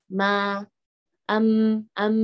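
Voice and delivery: "Ma, am, am" with light, open vowels; flat-tongue mim. Shown as a demonstration. Teacher has students try the letter mim, thin sound. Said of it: "lidah kita tuh kan datar".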